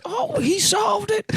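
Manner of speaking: high squeaky mock shout, slow; shrill falsetto amazement. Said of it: "they're like".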